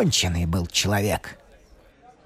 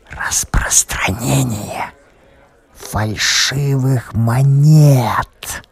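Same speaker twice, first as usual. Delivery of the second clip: slow, secretive whisper; leaning in to whisper; much slower than usual. A narrator's reading. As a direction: whisper it behind a hand. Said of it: "прошептал он".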